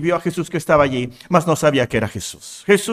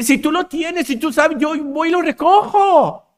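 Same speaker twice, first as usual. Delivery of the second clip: high-pitched, urgent plea; lightly acted woman begging for the body; pleading woman; 11 semitones higher, 4 dB louder than usual. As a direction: plead eagerly in a raised voice. Acts out Mary's desperate plea.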